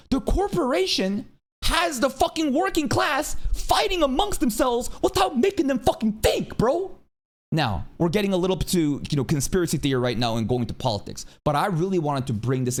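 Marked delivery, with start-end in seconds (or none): 0.1-6.9 pitched-up angry rant peak; heated rant peak. Real, not acted. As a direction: climb up and let the frustration burst out. Ranting climax, voice shoots up.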